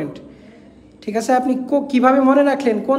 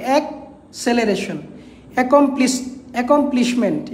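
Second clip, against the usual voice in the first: Acceleration drawn out slowly, syllable by syllable; slow teacher's model word acceleration; slow spelling demo; slower than usual. Shown as a demonstration. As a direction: stretch acceleration out so both c sounds come through. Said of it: "দুইটা সি উচ্চারিত হবে".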